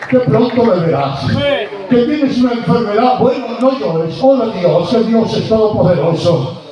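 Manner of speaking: loud, high fiery preaching; preaching at full force. Acted. Pastor exhorting the grieving congregation, loud and urgent. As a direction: press it on every listener.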